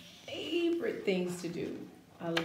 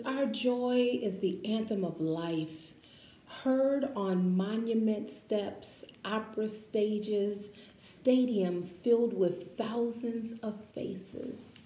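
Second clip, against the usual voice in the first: slow, expressive picture-book read-aloud; reading to children; 5 dB louder, slower than usual. Acted. Teacher reading a picture book to her class, expressive read-aloud.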